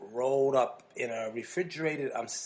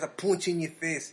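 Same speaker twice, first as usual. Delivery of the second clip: usual speaking voice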